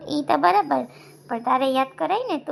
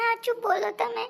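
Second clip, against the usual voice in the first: much higher, crying as she speaks; high, tearful demand; tearful plea; 7 semitones higher than usual. Acted. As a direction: beg for the truth through tears. Nishu crying, demanding truth.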